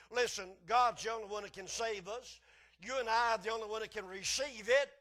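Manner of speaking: loud, rising pulpit exhortation; preaching at full force. Acted. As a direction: drive the point home to the congregation.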